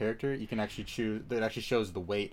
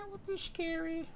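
much higher, squeaky mock fear; 4 dB quieter than usual. Shown as a demonstration. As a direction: squeak it out like a scared cartoon. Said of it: "repeated in a new voice".

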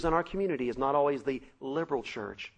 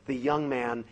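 normal talking voice

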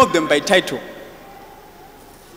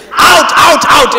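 higher, louder mock exorcist shout; mock careless deliverance ministers; mocking casting out; 8 semitones higher, 10 dB louder, much slower than usual. Preacher mocking careless exorcists, hammed-up loud shout. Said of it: "that you just say".

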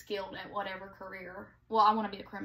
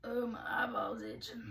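hoarse, half-whispered; rough husky voice; 3 dB quieter than usual. Real, not acted. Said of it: "Why do I sound like a drunk fifty-year-old man?".

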